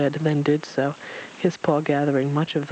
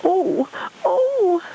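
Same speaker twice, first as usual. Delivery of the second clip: very high squealed exclamation; squirming little creature squealing; Piglet; 4 dB louder than usual. A narrator's reading. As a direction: squeal out two long ohs. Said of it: "Piglet said, squirming again".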